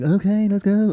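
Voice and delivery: high-pitched put-on quote; mock eager performer. Parent quoting his child's act, mock eager voice. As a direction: lift the pitch to start the show.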